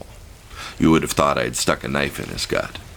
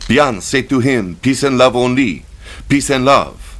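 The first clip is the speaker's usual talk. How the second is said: put-on high, loud pleading in heavy French-accented English; 10 semitones higher, 6 dB louder than usual. A narrator's reading. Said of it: "said in heavily accented English".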